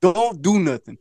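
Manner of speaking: low, measured imitation of calm advice; nice, calm. Man retelling how his friend addressed the kid, nice and calm. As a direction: talk the kid down calmly, pitched low. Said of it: "said it in a nice, calm way".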